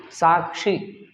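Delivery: साक्षी said with a clear क्ष, kʂ; साक्षी with retroflex क्ष; ksha with retroflex sh. Shown as a demonstration. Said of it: "साक्षी ना कि साक्षी".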